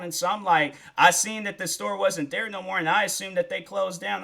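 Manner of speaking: ordinary delivery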